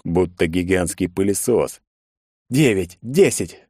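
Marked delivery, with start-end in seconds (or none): 2.5-3.6 straining count. A narrator's reading. Counting blows in fight.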